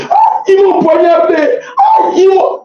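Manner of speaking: high-pitched imitation of panicked wailing; mock crying out. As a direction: mimic people crying out that they are hurt. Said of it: "commencent à, à, à crier". (imitation de cris aigus)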